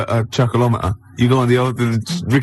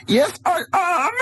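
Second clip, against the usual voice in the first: high excited yelp; slower than usual. Acted. Contestant answers game show host.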